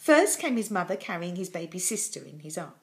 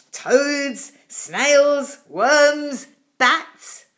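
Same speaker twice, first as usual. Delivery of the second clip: dragon listing food; 5 semitones higher, 10 dB louder, much slower than usual. A narrator's reading.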